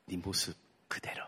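soft tender whisper. Retold or imitated. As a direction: breathe it to the child. Mother whispering to her son. (아이에게 속삭이듯)

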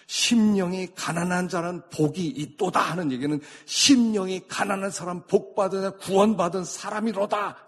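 high, loud pulpit declamation; forceful proclamation. Real, not acted. Preacher declares the poor in spirit saved, forceful proclamation. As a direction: ring out the verse and its promise.

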